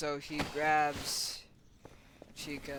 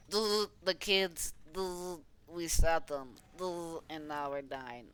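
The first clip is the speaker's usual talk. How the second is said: glitching character; 3 dB louder than usual. Pretend. Broken character stutters while dying.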